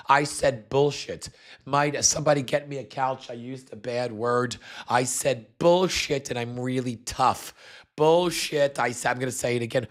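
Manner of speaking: high, smug mocking voice of a proud little boy; a hammed-up schoolboy showing off a bad word; mock Vance. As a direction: lampoon him as a kid thrilled at swearing. Mocking Vance bragging about swearing.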